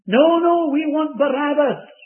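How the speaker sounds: high, loud demanding yell; crowd shouting. Acted. The crowd shouting to Pilate for Barabbas, loud and high. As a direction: cry out for Barabbas like an angry mob.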